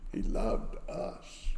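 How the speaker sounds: hushed awe. Real, not acted. Tender climax about God's love.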